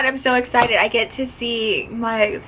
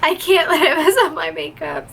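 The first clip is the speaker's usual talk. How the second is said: teary laugh; 6 semitones higher than usual. Real, not acted. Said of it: "I'm sorry, I'm just".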